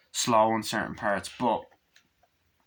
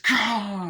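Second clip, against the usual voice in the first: pained cry; 10 semitones higher, 4 dB louder than usual. Retold or imitated. Doctor cries out in pain.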